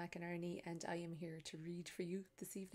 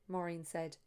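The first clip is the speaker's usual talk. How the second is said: story narration; 2 semitones higher, 6 dB louder than usual. A narrator's reading. Author reading her novel to an audience, lively storytelling. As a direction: read it to the room like a storyteller.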